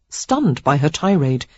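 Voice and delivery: plain reading voice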